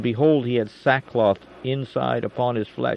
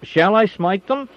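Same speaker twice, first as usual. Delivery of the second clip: much higher and louder, slower, pressing the question; eager repeat; 9 semitones higher, 5 dB louder than usual. A narrator's reading. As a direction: ask again, itching to strike. King repeats the question more urgently.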